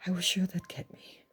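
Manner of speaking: soft unvoiced terrified whisper. A narrator's reading. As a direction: hush it, still shaking with fear.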